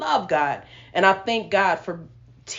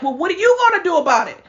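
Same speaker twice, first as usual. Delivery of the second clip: mock angry challenge; 8 semitones higher, 5 dB louder, much faster than usual. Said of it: "And I'm like".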